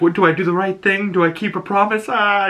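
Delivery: high-pitched, quick, hammed-up whimper; mock crying. Curly torn over her promise to Wendy, mock crying. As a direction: imitate a torn child crying. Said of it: "she's crying".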